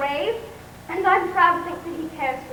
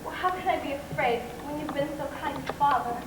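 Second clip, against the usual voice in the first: shy and soft; 3 semitones lower, 8 dB quieter than usual. Acted. Shy girl answering suitor.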